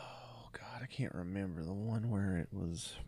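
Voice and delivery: unvoiced whisper to herself. Real, not acted. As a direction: whisper while searching memory. Trying to remember a name.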